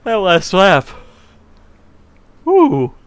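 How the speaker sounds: loud high whoop; excited cheer. Real, not acted. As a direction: celebrate the finish loudly. Celebrating finish.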